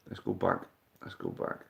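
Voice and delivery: whispered to himself, fading; quiet whispered self-reminder. Real, not acted. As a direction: breathe it out under the breath. Muttering to himself.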